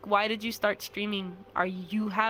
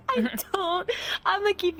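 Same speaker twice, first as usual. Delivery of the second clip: words squeaked out through real laughter; helpless giggling, pitched way up; laughing; 11 semitones higher than usual. Real, not acted. Streamer asked how they plan streams, laughing helplessly. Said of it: "I'm sorry, I'm not laughing".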